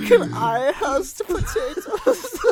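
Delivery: high, very slow mock German voice while laughing; laughing mock German accent; silly German accent. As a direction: ham up the German accent, cracking up. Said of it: "You make his voice worse".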